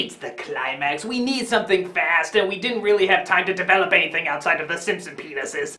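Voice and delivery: very low, soft confiding voice; villain's hushed excuse. Acted. Villain excuses the plot to Lucy, low and hushed. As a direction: drop low and quietly explain away the plot.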